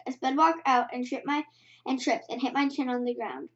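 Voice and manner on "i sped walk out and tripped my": usual speaking voice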